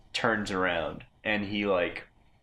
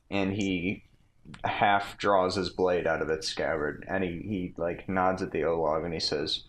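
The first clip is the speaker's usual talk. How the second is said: ordinary delivery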